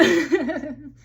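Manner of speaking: high, loud burst of laughter; amused laugh. Real, not acted. Card reader tickled by the lyric she quoted, amused laugh. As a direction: laugh out loud in a quick bubbling run.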